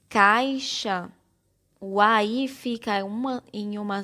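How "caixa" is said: "caixa" loud, drawn out in its syllables. Shown as a demonstration. Teacher showing how the word caixa separates, syllable by syllable. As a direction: split "caixa" slowly and firmly as cai-xa. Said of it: "Quando separamos silabicamente".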